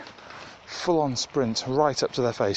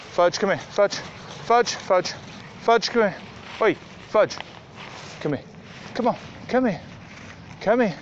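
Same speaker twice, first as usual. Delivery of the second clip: loud, high call across the field; calling the dogs; 9 semitones higher, 3 dB louder than usual. Real, not acted. Owner calling her dogs back on a walk, loud high call. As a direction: shout them back to heel.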